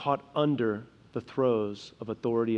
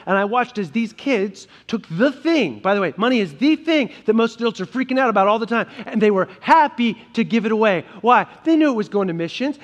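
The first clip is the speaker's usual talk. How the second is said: louder, higher, driving preacher's voice; full-force preaching; 5 semitones higher, 8 dB louder than usual. Acted. Pastor fires up the congregation about money, loud and impassioned. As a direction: build it up and push it to the back pew.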